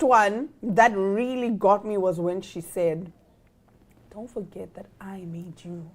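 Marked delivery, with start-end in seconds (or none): 4.1-5.9 hushed threat. Retold or imitated. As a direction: hiss it softly like a threat. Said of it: "And she's whispering".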